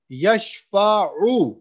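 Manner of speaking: यशफाउ slowed, ain with pesh distinct at the end; clear ain. Shown as a demonstration. Teacher isolates the ain in यशफाउ, slowly. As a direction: land on the ain then a short u in यशफाउ. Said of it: "आइन पेश उ".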